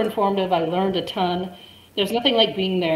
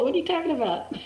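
higher mock indignant retort; indignant self-quote; 9 semitones higher, 3 dB quieter than usual. Teller retorting to the boyfriend, higher and amused. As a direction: toss it back, half laughing. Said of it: "I was like".

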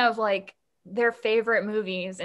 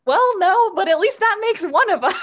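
wry joking; 9 semitones higher, 8 dB louder than usual. Retold or imitated. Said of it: "And he's like".

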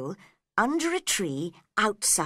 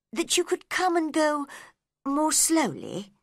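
polite; 8 semitones higher than usual. A narrator's reading. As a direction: ask nicely, slightly higher. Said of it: "she said politely".

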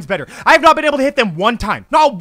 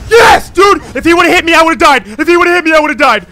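high, loud cheer of relief; excited relief; 5 semitones higher, 10 dB louder than usual. Real, not acted. Gamer who just escaped a hit, loud relieved cheering. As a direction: shout it like you barely survived.

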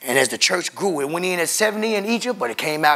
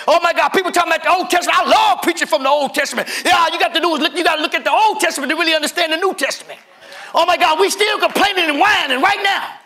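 higher and louder, voicing Moses' desperate complaint; full-force preaching; 11 semitones higher, 4 dB louder than usual. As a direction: preach it out, crying Moses' plea to God. Preaching climax, fast and laughing.

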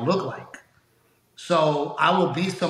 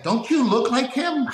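retold incredulous question, pitched much higher; insistent disbelief; 9 semitones higher than usual. Contractor shows comedian his own photo, incredulous. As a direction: imitate an amazed fan pressing the point.

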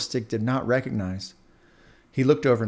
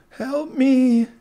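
much higher, loud plea; frightened cry; 13 semitones higher, 5 dB louder than usual. Acted. Left Earbud begs Popsicle Stick for help, frightened. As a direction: beg from the bottom of the drain. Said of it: "the earbud cried".

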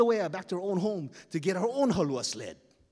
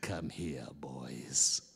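menacing whisper; 7 dB quieter, much slower than usual. Acted. Pele menacing whisper again.

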